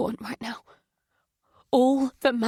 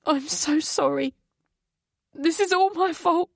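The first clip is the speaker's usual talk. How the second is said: choked apology; 7 semitones higher than usual. Acted. Mother blaming herself to her son, choked apology. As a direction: let the guilt break through. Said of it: "my voice choked with emotion".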